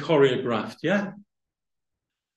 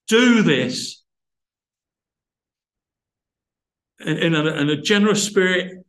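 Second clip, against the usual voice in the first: impassioned preaching; 4 semitones higher than usual. Acted. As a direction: preach it, building with fervor. Preaching builds to full force.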